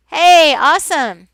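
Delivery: loud, upbeat cheer; enthusiastic approval. Acted. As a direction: sell it like a happy thumbs up. Thumbs-up gesture acted out.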